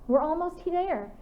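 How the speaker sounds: high, slow, eager call ahead; excited yell. A narrator's reading. Wolf girl calling to friends behind, excited yell. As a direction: call back to the wagon, thrilled we're close. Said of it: "Claire's wolf form yelled".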